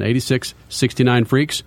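ordinary delivery

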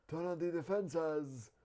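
slow, breathy mock African accent; breathy Batman-like take on an African accent; bad African accent. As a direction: try an African accent, badly, drawn out and breathy. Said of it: "in one of the worst African accents".

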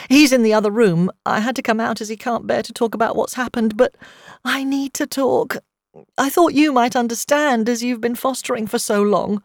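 distraught, needing to talk; upset and tearful. A narrator's reading. Upset friend confiding in a fellow foster carer, tearful.

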